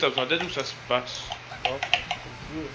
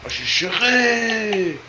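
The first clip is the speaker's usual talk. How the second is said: loud, high, triumphant cheer; excited shout; 7 semitones higher, 8 dB louder than usual. Real, not acted. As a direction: cheer the big play. Celebrating a play.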